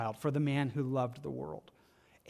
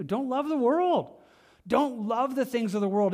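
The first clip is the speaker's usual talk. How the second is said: urgent plea; 6 semitones higher than usual. Real, not acted. Preacher's climax command.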